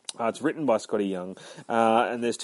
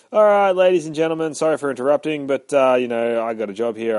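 raised, louder showman's address; loud announcement; 5 semitones higher, 9 dB louder than usual. Retold or imitated. Deadpool addresses the cinema audience, loud mock announcement. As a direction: call out to a whole room, imitating a brash announcer.

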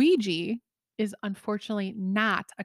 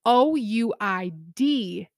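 slow, letter by letter; spelling out; slower than usual. Real, not acted. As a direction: spell O U I D A slowly, each letter apart. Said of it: "[name], letters".

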